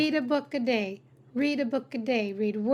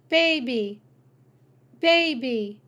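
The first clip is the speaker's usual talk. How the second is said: usual speaking voice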